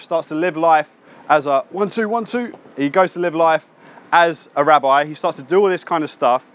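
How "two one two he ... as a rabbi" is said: usual speaking voice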